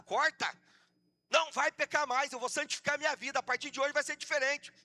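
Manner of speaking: loud, high commanding delivery; fiery preaching. Real, not acted. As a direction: proclaim it forcefully to the church. Preaching at full force.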